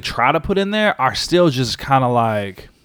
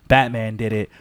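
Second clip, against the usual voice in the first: normal talking voice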